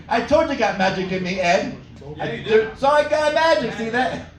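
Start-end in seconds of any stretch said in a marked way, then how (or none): none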